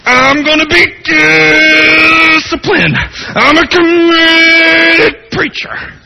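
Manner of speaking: high, loud, slow sanctimonious voice; mock pious preacher. Preacher mocking his own self-reliance, sanctimonious. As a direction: drag it out smugly.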